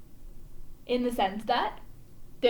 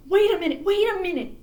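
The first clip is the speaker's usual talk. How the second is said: higher, louder call to stop; crying out; 6 semitones higher, 6 dB louder than usual. A narrator's reading. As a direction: call out to halt everyone. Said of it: "cried Jack".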